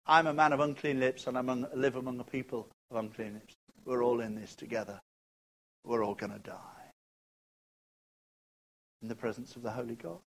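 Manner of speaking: soft, low resigned voice; almost whisper the doom; quiet resigned dread; hushed despair. Acted. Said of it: "voicing Isaiah hushed".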